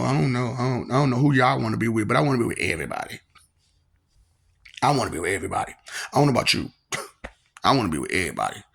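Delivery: ordinary delivery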